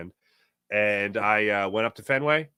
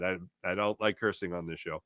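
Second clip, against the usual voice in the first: usual speaking voice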